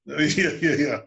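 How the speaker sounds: ordinary talk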